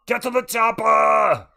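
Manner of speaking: high, loud, drawn-out mock-Austrian shout; Austrian accent. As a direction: holler it like an Austrian action hero.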